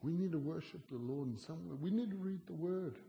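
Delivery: rising preaching. Real, not acted. Preaching builds high and loud.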